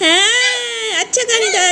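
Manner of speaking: high, hammed-up crying; mock wailing. Mother praises her own story to children, mock wailing. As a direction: cry it out theatrically.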